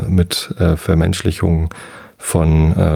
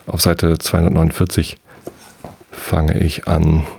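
plain reading voice

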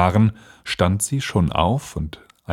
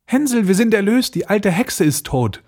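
put-on high-pitched, jubilant little girl; Gretel calling out; 12 semitones higher than usual. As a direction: call out the good news in a high girlish voice. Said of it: "öffnete sein Ställchen und rief". (jubelnd rufendes Mädchen)

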